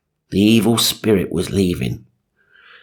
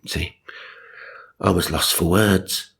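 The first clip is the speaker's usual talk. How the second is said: normal talking voice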